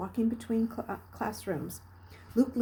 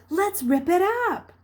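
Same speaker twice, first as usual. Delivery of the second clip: high, loud shout to the gang; boy shouting; 11 semitones higher, 7 dB louder than usual. A narrator's reading. Boy urging the gang to wreck the garden, shouting. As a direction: yell it as a rallying cry. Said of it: "calling to another".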